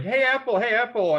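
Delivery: high, loud cartoon pest; Annoying Orange. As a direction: call out in a high, pestering cartoon voice. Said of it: "Annoying Orange".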